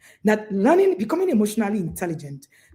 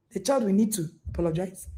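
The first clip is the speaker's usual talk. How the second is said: usual speaking voice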